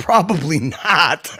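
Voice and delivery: slow answer breaking into a laugh. Real, not acted. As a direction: say it slowly, then crack up laughing. Joking doubt about guest's well-being.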